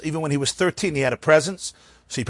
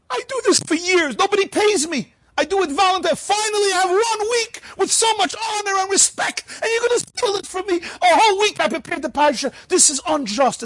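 much higher, wailing old man's mock lament; half-sob out the injustice; near sobbing. Old Moshe lamenting to the gabbai, high and near tears. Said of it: "the man is virtually sobbing".

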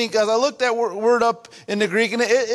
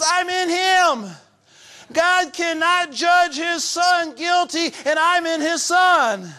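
preaching at full force; 7 semitones higher than usual. Acted. Preaching climax.

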